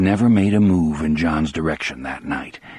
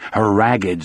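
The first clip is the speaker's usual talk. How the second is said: usual speaking voice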